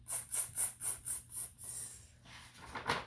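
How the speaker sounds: soft, voiceless monkey chatter; mimicking monkeys. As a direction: hiss little tss sounds like cheeky monkeys. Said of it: "shook both their hands back at him".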